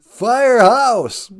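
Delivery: loud, slow, high shout of the title; excited call. Real, not acted. Host hollering the song's hook back at the band, slow and loud. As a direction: call it out like a chant.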